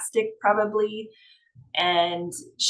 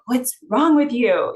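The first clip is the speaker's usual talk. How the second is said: higher, louder exasperated snap; frustrated; 6 semitones higher, 6 dB louder than usual. Acted. Teacher voices a frustrated character's line, exasperated and loud. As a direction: fire it back in irritation.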